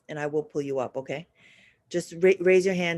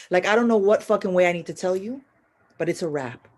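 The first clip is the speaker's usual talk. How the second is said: ordinary talk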